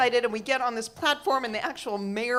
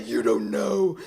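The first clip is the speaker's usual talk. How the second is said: slow, drawn-out mock anguish; hammed-up, stretched-out plea; desperate warning; 2 semitones lower, 3 dB louder, slower than usual. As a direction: confess a dark secret to a child. Imagined desperate warning to kids.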